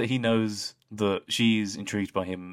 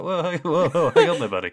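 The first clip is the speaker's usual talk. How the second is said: high, loud laughing backing off; laughing mock alarm; laughing whoa; 10 semitones higher, 9 dB louder than usual. Real, not acted. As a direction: laugh while pulling back, hands up.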